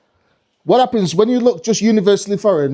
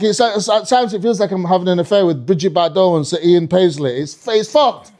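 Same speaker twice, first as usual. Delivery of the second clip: ordinary talk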